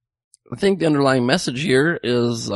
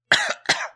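choking cough. Real, not acted. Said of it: "Sorry for that cough".

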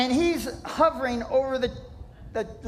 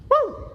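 excited whoop; 13 semitones higher, 4 dB louder than usual. Real, not acted. Shout capping the sermon.

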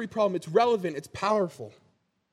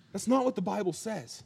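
normal talking voice

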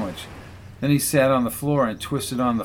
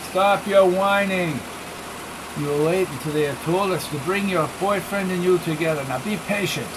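put-on high, Spanish-accented scold; 5 semitones higher than usual. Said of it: "His thick Spanish accent".

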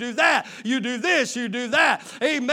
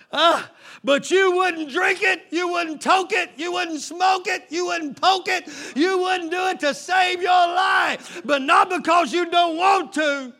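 rising preaching shout; 6 semitones higher, 3 dB louder than usual. Real, not acted. Preacher drives the point home to the church in a full high shout. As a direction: pound each line harder at the congregation.

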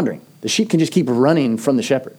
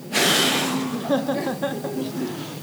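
thoughtful mock sigh; 12 semitones higher, 7 dB quieter than usual. Mock kid pondering.

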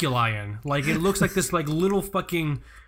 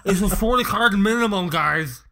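puffed-cheek lisping gamer voice; nerdy gamer voice; 3 semitones higher than usual. Acted. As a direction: cheeks puffed, spitting on the s sounds. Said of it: "an entire episode with that voice".

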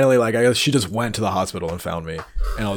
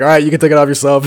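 hurried medic; 5 semitones higher, 8 dB louder, faster than usual. Retold or imitated. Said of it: "they're like".

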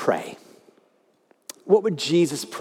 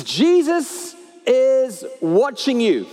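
slow, slightly higher mysterious voice; eerie disembodied voice; 6 semitones higher, 3 dB louder, much slower than usual. Acted. Hidden parrot warns the burglar, slow eerie voice. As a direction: spook the burglar from the dark. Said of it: "It says".

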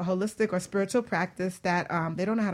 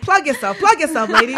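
teasing call to plug; 7 semitones higher, 10 dB louder than usual. Retold or imitated. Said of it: "I'm like".

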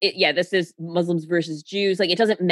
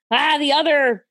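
mock caveman panic; 5 semitones higher, slower than usual. Mocking primal fear of rivals.

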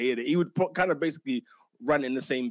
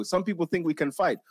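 ordinary talk, nothing marked

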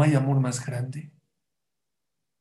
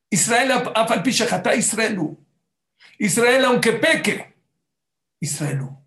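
high, raised preacher's proclamation; fervent preaching; 8 semitones higher than usual. Real, not acted. Preacher quotes that Israel stays Israel, fervent and high. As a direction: cry out the verse with fervour.

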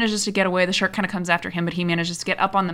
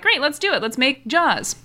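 higher-pitched, lightly hammed-up enthusiasm; mock eagerness; 6 semitones higher than usual. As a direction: imitate producers gamely jumping in. Acting out the producers' eager decision.

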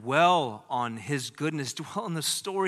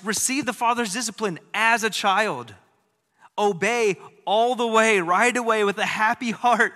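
louder, driving sermon voice; fervent preaching; 5 semitones higher, 6 dB louder than usual. Acted. Pastor urging the congregation to obey with a happy heart, fervent preaching. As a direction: press it home from the pulpit.

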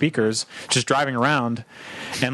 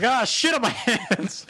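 high, laughing panicked cry; freaked out; 8 semitones higher, slower than usual. Retold or imitated. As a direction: yelp it half laughing, grossed out. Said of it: "and I freaked out".

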